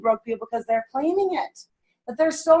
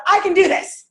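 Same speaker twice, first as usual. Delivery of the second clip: loud, higher, upbeat declaration; energetic affirmation; 8 semitones higher, 5 dB louder than usual. Shown as a demonstration. Leading the audience in a loud affirmation. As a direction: lead the crowd in saying it. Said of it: "I want you all to say out loud".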